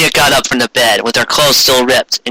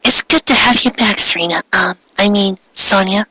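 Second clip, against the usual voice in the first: light Ami voice; 6 semitones higher than usual. Acted. Ami welcoming Sonia back.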